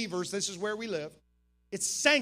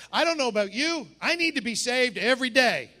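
ordinary talk

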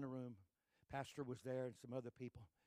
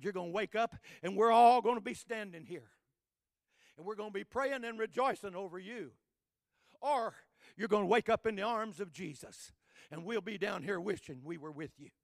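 preaching nurse's promise; 7 semitones higher, 16 dB louder than usual. Acted. Nurse's words preached at full force.

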